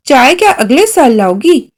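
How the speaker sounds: ordinary delivery, nothing marked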